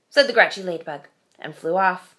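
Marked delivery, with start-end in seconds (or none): none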